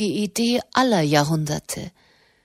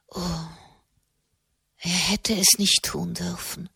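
soft, quieter lament; 4 dB quieter than usual. Acted. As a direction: sigh the reproach out softly. Katharina wiederholt klagend. (leise Klage)

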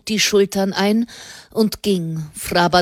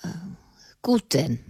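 breathy resigned near-whisper; 5 dB quieter than usual. A narrator's reading. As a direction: give in with a sigh, barely voiced. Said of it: "sagte Fra Bartolomeo resigniert".

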